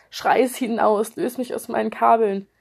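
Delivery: usual speaking voice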